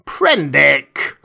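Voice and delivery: drawn-out call of a name; mild narrated cry to cut someone off. A narrator's reading. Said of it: "cried Moreau".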